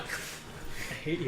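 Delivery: mock whisper. Mock spite at friend.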